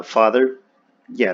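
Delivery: ordinary talk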